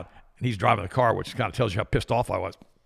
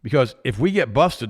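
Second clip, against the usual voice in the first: firm refusal; 5 semitones higher, 6 dB louder, slower than usual. Retold or imitated. Retold confrontation in the car.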